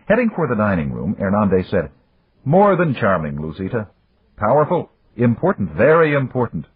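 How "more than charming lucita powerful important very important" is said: lightly voiced proud father, higher; a proud father selling the match; father's voice. Father urging his daughter to marry, lightly voiced proud.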